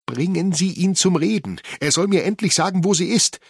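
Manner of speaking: high, loud frantic plea; loud, high-pitched desperate demand; desperate pleading. A narrator's reading. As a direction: beg the police at the top of your voice. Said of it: "flehte sie die Constables an".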